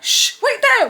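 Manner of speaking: high, loud mock shushing; urgent hushing order. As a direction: shush a sister and order her to stay. Hushes sister, orders her to wait.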